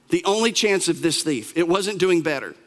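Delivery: ordinary talk, nothing marked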